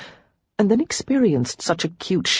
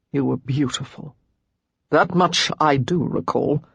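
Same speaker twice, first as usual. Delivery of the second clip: tender; 2 semitones lower than usual. A narrator's reading. Hunter tender compliment.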